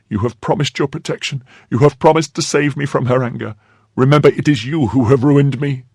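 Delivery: high-pitched accusing plea; distressed crying out. A narrator's reading. Maid begging her protector after his promise, high distressed cry. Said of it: "she cried".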